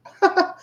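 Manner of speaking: short high burst of laughter. Real, not acted. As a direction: laugh out loud at the compliment. Amused at being praised.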